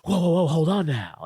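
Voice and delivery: a hint of a cocky wrestler cutting in; easy, smug interruption in a put-on voice; light Rock impression stopping him cold; lightly voiced The Rock. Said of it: "The Rock's just like".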